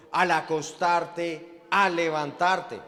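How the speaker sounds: slow, deliberate stress on each al. Acted. Preacher driving the verse home, slow and stressed. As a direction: al acostarte, al levantarte, slow and weighty.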